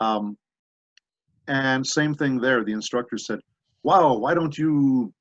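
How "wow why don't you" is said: high, loud, drawn-out wow; impressed praise. Retold or imitated. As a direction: imitate an amazed instructor, slowly and loudly. Acting out impressed instructor's reaction.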